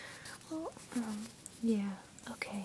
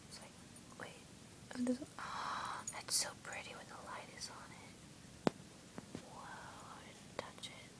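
hushed whisper, barely any voice; 10 dB quieter than usual. Real, not acted. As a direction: whisper it so nobody hears. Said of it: "I'm whispering".

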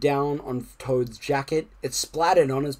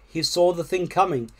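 ordinary delivery, nothing marked

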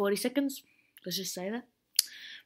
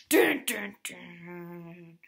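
suspense dun dun dun; 3 semitones lower, 4 dB louder, much slower than usual. Pretend. Suspense sound effect.

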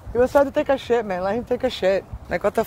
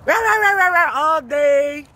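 whining rah rah; 3 semitones higher than usual. Retold or imitated. Imitating the whining puppy all day. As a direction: whine like a dramatic puppy. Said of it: "Complaining, bitching".

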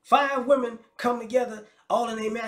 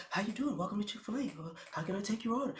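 polite cashier voice; 9 dB quieter, faster than usual. Retold or imitated. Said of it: "They'll teach you how".

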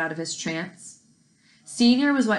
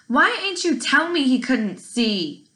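raised, slow, insistent question; louder question; 6 semitones higher, 5 dB louder, slower than usual. A narrator's reading. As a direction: press the question out slowly. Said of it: "a question he needed to be asked out loud".

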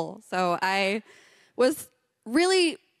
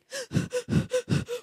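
very high indignant gasp; offended gasp. Retold or imitated. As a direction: gasp as if wronged. Startled when husband calls her.

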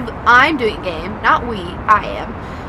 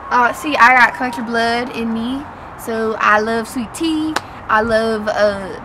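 put-on American country drawl accent; 2 semitones lower than usual.

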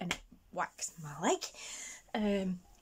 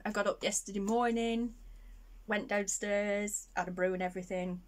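ordinary delivery

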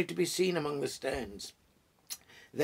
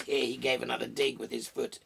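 usual speaking voice

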